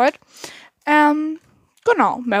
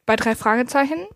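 ordinary talk

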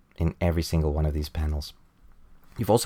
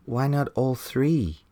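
higher and slow, playfully wicked; vampire maid; 7 semitones higher, slower than usual. Retold or imitated. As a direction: purr it as a sly mock-sweet maid. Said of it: "the vampire maid says".

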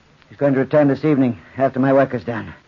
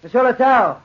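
calling out; 8 semitones higher, 3 dB louder than usual. Acted. Calling at locksmith's door at night.

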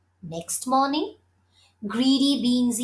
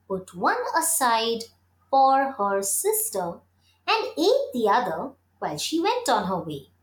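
usual speaking voice

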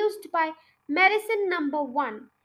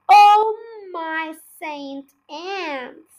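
slow, louder, drawn-out exclamation; dismayed cry; 8 dB louder, much slower than usual. A narrator's reading. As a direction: wail it out in disbelief. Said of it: "cried Mister Killiecranky".

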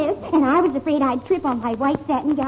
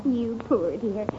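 tender; 4 semitones lower, 6 dB quieter, much slower than usual. Acted. Wife soothes tired husband.

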